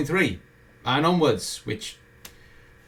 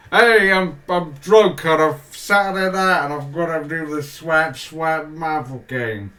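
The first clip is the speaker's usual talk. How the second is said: put-on drunk gamer; 2 semitones higher, 5 dB louder than usual. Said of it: "having to go like".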